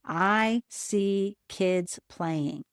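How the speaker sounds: slow, word by word; saying slowly. Shown as a demonstration. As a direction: count each word off slowly. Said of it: "say the sentence slowly and put up one finger for each word".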